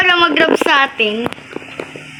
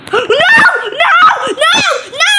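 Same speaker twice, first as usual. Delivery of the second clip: panicked no; 7 semitones higher, slower than usual. Real, not acted. Caught, panicked cries.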